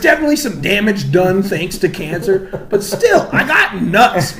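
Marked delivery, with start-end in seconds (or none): 2.7-4.4 high, loud indignant insistence; emphatic protest. Real, not acted. Insisting against the rumour.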